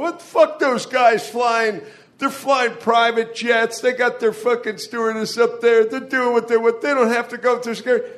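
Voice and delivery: high-pitched mock outrage; hammed-up resentful rant; indignant griping. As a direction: complain enviously, voice pushed up high. Acts out envious people complaining.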